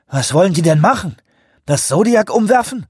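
high, loud frightened questions; panicked. A narrator's reading. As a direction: ask it in rising panic.

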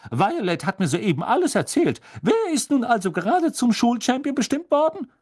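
pry eagerly like a gossiping portrait; Fat Lady voice. Acted. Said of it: "sagte die fette Dame".

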